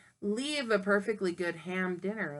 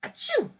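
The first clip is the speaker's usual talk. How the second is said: acted sneeze. Shown as a demonstration. Teacher showing what a sneeze sounds like, mock sneeze. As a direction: sneeze it out sharply. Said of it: "which comes out of your nose".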